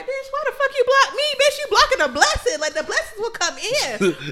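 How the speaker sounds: high, loud hammed-up rant with laughter; mock outrage. Ranting at the man who blocked her, loud mock outrage. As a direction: blast him as if truly offended, half laughing. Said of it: "I was more like".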